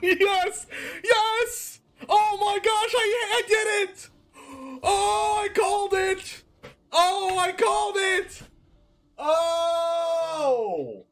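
excited shouting. Real, not acted. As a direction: shout with pure joy. Lucky topdeck celebration.

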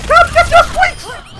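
high, slow hamster-like squeal; squeaky call. Pretend. Calling teammate in squeaky voice.

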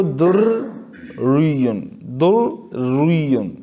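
दुर्रियुन slowly broken in two after the first R; split R, wrong reading. Shown as a demonstration. Teacher shows students the error, दुर्रियुन split in two. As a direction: halt inside दुर्रियुन, dur then riyun, as two separate Rs. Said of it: "ऐसे नहीं कि पहले को बारीक पढ़ो".

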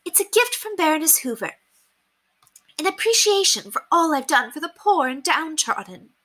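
high lady's voice, smug and proud; high smug aristocrat boast. Acted. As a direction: brag about her charity. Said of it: "resumed her boasting".

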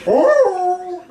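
loud high Tarzan call; Tarzan yell. Pretend. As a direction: belt out a jungle yell. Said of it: "I'd do an imitation Tarzan".

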